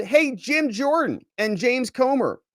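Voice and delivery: high, slow sarcastic paraphrase; mocking the letter's tone, drawn out high; sarcastic mock quote of the letter. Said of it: "He said".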